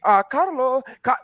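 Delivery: high, put-on voice of his Colombian friend; a quick, high imitation of Andrea calling him; imitating Andrea. Friend mimics Andrea asking about his bank accounts, high put-on voice. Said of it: "'cause I can't do her voice".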